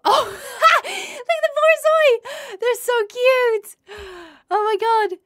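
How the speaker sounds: high, slow squeal over a cute dog; squealing with delight at a cute dog; excited squeal. Real, not acted. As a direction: gush and squeal, nearly crying.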